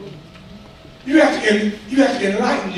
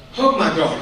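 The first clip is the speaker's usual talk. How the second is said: normal talking voice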